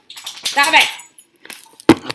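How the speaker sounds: real shout at the dogs. Real, not acted. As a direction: shout them apart, sharp and loud. Yelling at wrestling dogs.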